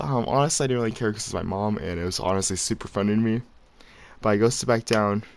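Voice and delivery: ordinary delivery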